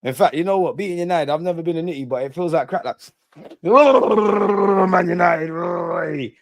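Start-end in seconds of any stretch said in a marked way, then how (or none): 3.6-6.3 drawn-out jeer. Real, not acted. Jeering at rival club.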